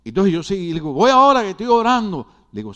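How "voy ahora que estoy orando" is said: calling out. Retold or imitated. Called answer through the door.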